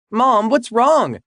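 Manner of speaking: high, slow, anxious daughter; worried daughter. Acted. Daughter asking crying mom what's wrong, high and anxious. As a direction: ask with real worry.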